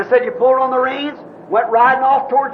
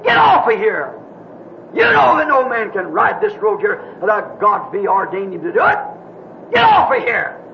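commanding; 3 semitones lower than usual. Retold or imitated. Preacher retells the rider ordering a man off the road, commanding shout. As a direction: order him off with full authority. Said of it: "You commanded".

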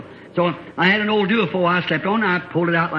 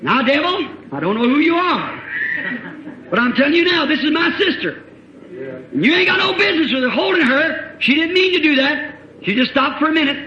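rebuking the devil; 9 semitones higher than usual. Retold or imitated. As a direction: confront the devil head on.

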